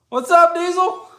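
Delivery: high, loud happy greeting; excited call to dogs. Real, not acted. Greeting the dogs outside, loud and excited.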